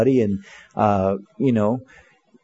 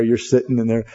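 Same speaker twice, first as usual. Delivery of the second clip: normal talking voice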